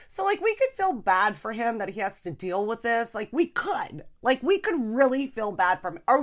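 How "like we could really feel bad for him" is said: normal talking voice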